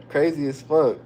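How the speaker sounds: usual speaking voice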